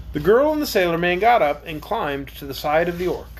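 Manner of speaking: plain reading voice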